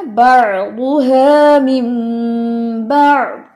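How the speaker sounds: slow chanted tajweed recitation; Quranic recitation. Shown as a demonstration. Teacher chanting the verse for the student. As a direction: draw the words out in recitation. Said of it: "इकलाब की मिकदार पूरी नहीं की".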